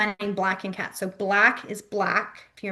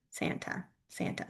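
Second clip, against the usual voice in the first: hushed, barely voiced word; whispered demo; 13 dB quieter than usual. Shown as a demonstration. Teacher showing the Santa sign, whispered. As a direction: murmur it under your breath while demonstrating.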